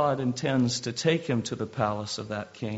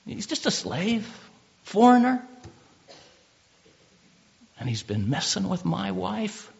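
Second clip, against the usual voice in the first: indignant, higher-pitched outrage; mock outrage of a wronged husband; piping mad; 6 semitones higher than usual. Preacher voicing a husband enraged at a slave, piping mad. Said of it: "he was piping mad".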